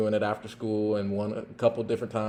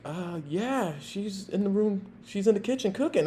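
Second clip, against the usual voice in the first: put-on meek kid; 9 semitones higher, 4 dB quieter than usual. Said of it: "and he's like".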